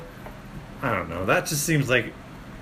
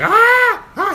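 short high, loud scream; imitated scream; 8 dB louder than usual. Storyteller imitates the man waking up, screaming. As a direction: mimic a man screaming in shock. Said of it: "he starts screaming".

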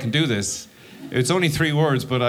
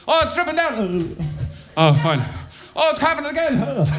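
much higher, dazed whimpers; stoned wonder; 13 semitones higher than usual. Retold or imitated. Two stoned friends holding ice creams, high dazed whining. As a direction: marvel helplessly at melting ice cream. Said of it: "we're like".

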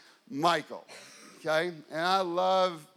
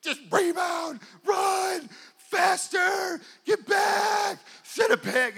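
high, strained sideline yelling; shouted commands in short high bursts; screaming coach; 11 semitones higher, 3 dB louder, slower than usual. Retold or imitated. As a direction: holler frantic orders across a basketball court. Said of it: "screaming all game".

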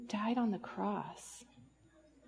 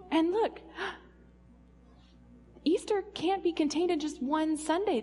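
excited; 5 semitones higher than usual. Acted. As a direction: burst out with delight. Excited discovery.

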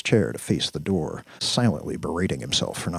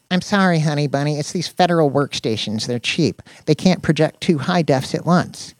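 higher-voiced tech nerd making excuses; apologetic Danny; 9 semitones higher, 4 dB louder than usual. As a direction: explain apologetically to your girlfriend.

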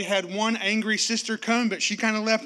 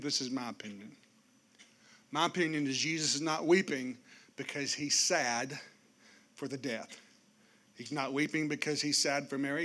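ordinary talk, nothing marked

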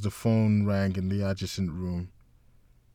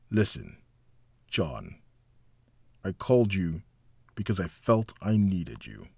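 softened tone. A narrator's reading. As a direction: soften and speak gently. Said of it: "softening his tone".

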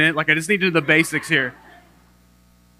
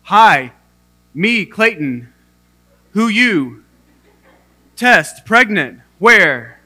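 loud, slow, choppy one-word bursts; broken beginner speech; 4 semitones higher, 9 dB louder, much slower than usual. Retold or imitated. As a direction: bark single words like a lost beginner. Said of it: "What I sounded like in Arabic was".

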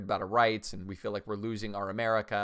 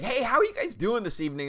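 much higher, louder cheery hello; upbeat greeting; 11 semitones higher, 5 dB louder than usual. Retold or imitated. Greeting people arriving at the gym, cheery and bright. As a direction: greet them like sunshine walking in.

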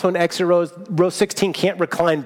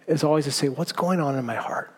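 gentle reassurance; 2 semitones lower, 7 dB quieter than usual. Pretend. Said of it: "God would offer to us a way of saying".

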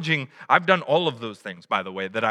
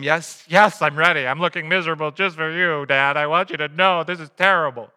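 mock sulky kid; 4 dB louder than usual. Voicing a sulky child at shopping.

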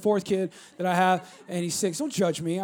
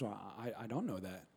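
put-on child unsure; 20 dB quieter than usual. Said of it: "He goes".

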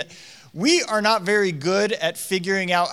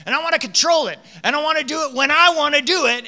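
high, loud, insistent mock whine; hammed-up childish protest; tantrum-throwing child; 8 semitones higher, 5 dB louder, faster than usual. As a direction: demand control like a stubborn toddler. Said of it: "I live my life so often like".

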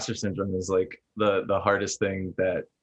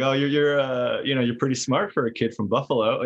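passive aggressive; 5 semitones higher than usual. Retold or imitated. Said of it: "that's a bad voice".

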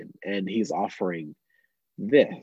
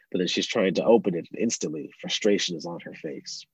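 usual speaking voice

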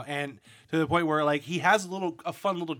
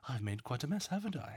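lilting jape; 8 dB quieter than usual. Retold or imitated. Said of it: "his little lilting, uh, jape".

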